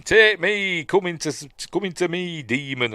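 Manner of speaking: high, loud desperate dare; shouted challenge. Retold or imitated. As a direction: yell at the demon to take you instead. Priest goads demon to enter him.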